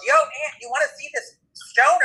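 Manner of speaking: high-pitched mock call-out; yelling. Partner calling out about the stone, high excited yell. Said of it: "my partner yells".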